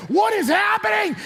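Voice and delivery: high, loud shocked cry; a boy spun in circles by a bigger kid; mock panic, pitch leaping; bewildered. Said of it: "My son's like".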